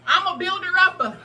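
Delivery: energized workout cheer; pumped through the burn. Real, not acted. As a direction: hype yourself up mid-exercise. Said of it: "It's burning".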